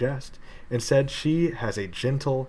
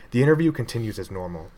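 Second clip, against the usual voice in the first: plain reading voice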